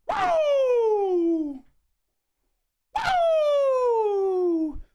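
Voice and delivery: long high falling woo; jubilant whoops. Real, not acted. Fan celebrating a goal, long high whoops.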